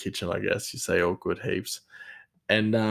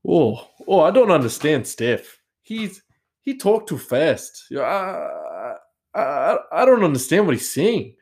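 imitating a Brazilian accent, higher and louder; mock Brazilian English, pitched up; Brazilian coworker; 7 semitones higher, 4 dB louder than usual. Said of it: "he was like".

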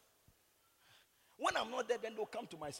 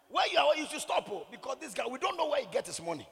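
retold urgent warning; 3 semitones higher, 8 dB louder than usual. Voices people warning his worker.

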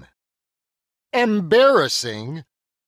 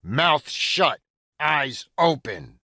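ordinary talk

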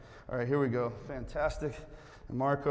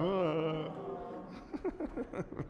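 long, softer fake whimper; mock crying; 9 dB quieter than usual. Shown as a demonstration. Rejects told to just cry, badly faked crying. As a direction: pretend to cry in one drawn-out wail. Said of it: "Y'all just cry".

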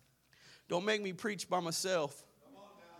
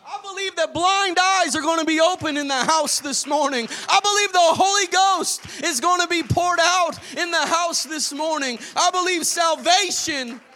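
rising, loud preacher's build, higher and louder; preaching at full force; 8 semitones higher, 11 dB louder than usual. Acted. Preacher rousing the Sunday congregation, building to a shout. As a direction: lift the congregation, pushing to a shout.

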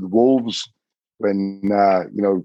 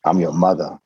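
a low, gentle hint of an elder; lightly voiced old woman; 3 semitones lower than usual. Lakota elder greets the visitor as son, lightly voiced and low. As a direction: lightly suggest a welcoming old mother, voice lowered.

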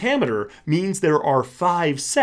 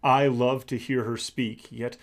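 softer, measured poetry recital; poem reading; 3 dB quieter than usual. Acted. Teacher reading Shakespeare's sonnet to the class, softer poem recital. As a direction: read the sonnet as verse, unhurried. Reads Sonnet 130 aloud.

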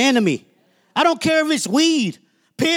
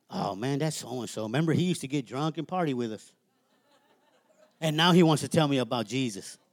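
put-on much lower and softer, a scoffing old buddy with a laugh; mocking hometown crowd; 12 semitones lower, 9 dB quieter than usual. Mimicking hometown friends, low and scoffing. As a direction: sneer like old party friends who can't believe it. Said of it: "they still looking at you like".

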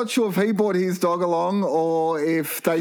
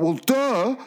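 high, mocking well duh; sarcastic duh; 7 semitones higher than usual. Patient answering the nurse, high sarcastic duh. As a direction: throw it back, high and snarky. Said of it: "And I went".

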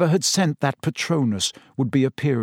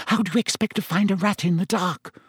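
sharp, higher retort; a sharp snap at Harry; snapped; 7 semitones higher than usual. A narrator's reading. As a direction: cut him off, irritated. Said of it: "snapped Hermione".

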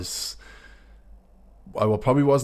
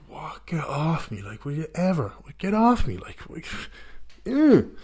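half-asleep protest; 7 semitones higher, slower than usual. Retold or imitated. Still-drunk guest shoved awake in a hostel, groggy protest. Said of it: "your eyes aren't even open".

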